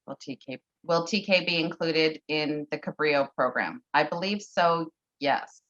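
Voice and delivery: ordinary talk, nothing marked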